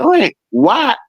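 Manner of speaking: high, puzzled "why"; baffled, high-pitched. Retold or imitated. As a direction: ask it in high disbelief. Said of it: "I'm like".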